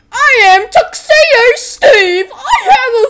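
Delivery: loud, high, drawn-out character bellow; hammed-up bellowing grand entrance; Tuxedo Steve. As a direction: announce yourself in a slow, over-the-top shout. Tuxedo Steve introduces himself, bellowed.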